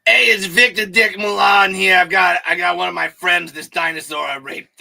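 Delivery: loud, high brash character voice; Victor Milan voice. Pretend. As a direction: play a brash loudmouth. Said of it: "Why don't you be Victor Milan".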